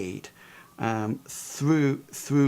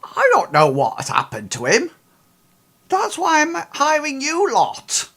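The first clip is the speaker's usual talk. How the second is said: higher, louder woman's voice; curt Ida; 10 semitones higher, 6 dB louder than usual. Acted. Ida telling the hired investigators to do their job, a higher curt woman's voice. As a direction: put them in their place as the employer. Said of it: "she says".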